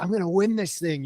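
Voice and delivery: high-pitched retold bravado; carried away. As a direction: act out getting carried away with winning. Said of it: "kinda got carried away".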